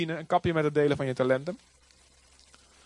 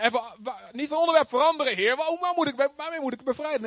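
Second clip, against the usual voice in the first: high, fast, flustered protest; flustered Moses; 7 semitones higher than usual. Retold or imitated. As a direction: stall the Lord nervously. (hoog en gejaagd protesterend tegen de Heer)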